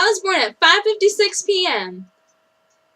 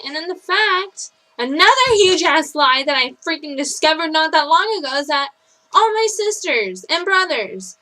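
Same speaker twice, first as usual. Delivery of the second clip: ordinary delivery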